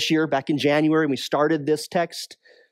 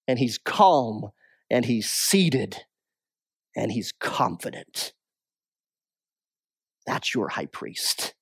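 hushed reassurance; 9 semitones lower, 6 dB quieter than usual. Acted. Calm assurance of the priest.

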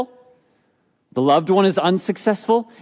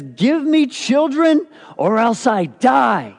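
slow, higher and louder desperate cry; angry demand; 8 semitones higher, 4 dB louder, slower than usual. Acted. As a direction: plead like a barren wife at the end of her rope. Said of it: "she's angry at her husband".

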